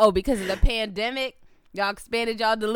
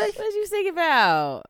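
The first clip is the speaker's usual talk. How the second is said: mock outrage; 9 semitones higher than usual.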